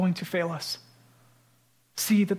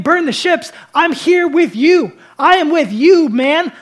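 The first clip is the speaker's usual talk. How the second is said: much higher and louder, ringing declaration; preaching at full force; 8 semitones higher, 12 dB louder than usual. Retold or imitated. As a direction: proclaim it like a battle cry of victory. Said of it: "This is Jesus saying".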